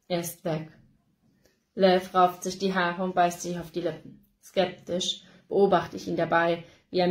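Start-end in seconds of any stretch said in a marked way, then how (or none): 0.1-0.7 resigned. A narrator's reading. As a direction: let it settle with defeat. Final resigned realization.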